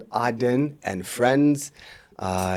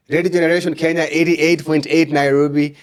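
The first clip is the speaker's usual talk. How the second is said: radio announcer voice; 5 semitones higher, 6 dB louder than usual. Real, not acted. Announcing the station on air.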